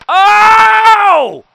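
long, high shouted "oh"; real scream at a big hit; huge high-pitched holler; excited scream. Real, not acted.

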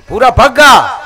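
loud, high irritated snap of a name; angry shout. Acted. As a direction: bark the name back in annoyance. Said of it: "ભૂરો ખીજાઈને બોલ્યો".

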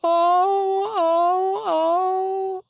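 high, drawn-out wails of grief; loud wailing. Acted. Pinocchio wishes he could die, high loud wailing. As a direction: wail "oh" loudly, very high.

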